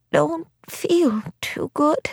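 high, slow, feeble granny voice; ailing grandmother, drawn out; weak old granny. Acted. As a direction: speak weakly, high and slow, as a sick old woman. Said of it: "said in a weak voice".

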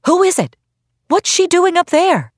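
high, loud calls from the crowd; crying out. A narrator's reading. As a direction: shout up in alarm. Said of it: "someone cried".

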